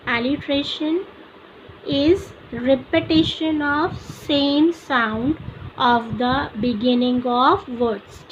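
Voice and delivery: very slow, word by word for notes; slow dictation. Shown as a demonstration. Teacher dictates definition to students, very slow. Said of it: "So what is alliteration?".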